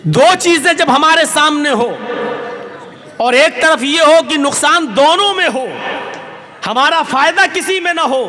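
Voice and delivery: ordinary talk, nothing marked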